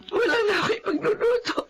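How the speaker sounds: soft, high, broken sobbing lines. Acted. Remedios to Andres, listing what she lost, sobbing. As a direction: cry through each loss, breaking down.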